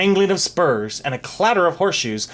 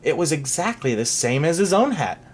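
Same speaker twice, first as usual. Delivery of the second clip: plain reading voice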